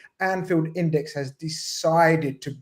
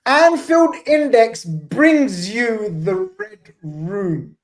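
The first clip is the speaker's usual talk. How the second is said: loud, drawn-out ring-announcer call; big high-pitched emcee opening; show announcer intro; 5 semitones higher, 5 dB louder, much slower than usual. Acted. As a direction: announce the show grandly, stretching each word.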